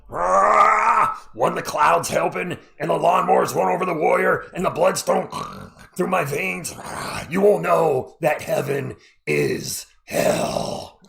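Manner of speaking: slow, roaring wrestler promo; Ultimate Warrior. Retold or imitated. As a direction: growl it out like a wild wrestler. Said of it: "on that Ultimate Warrior caffeine".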